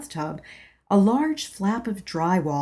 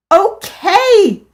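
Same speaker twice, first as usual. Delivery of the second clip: much higher, loud yell; yelled okay; 13 semitones higher, 12 dB louder than usual. Acted. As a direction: shout in surrender. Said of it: "I yelled".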